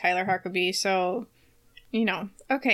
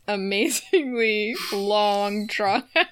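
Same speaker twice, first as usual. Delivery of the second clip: drawn-out emphasis; much slower than usual. Acted. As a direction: stretch "amazingly long, drawn-out" very slowly. Mimics the long sentence mockingly.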